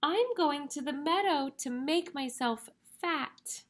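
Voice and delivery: high little goat voice; very small voice. Acted. Tiniest goat tells the troll his plan, small voice. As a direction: pitch it up like a tiny goat. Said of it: "in his very small voice".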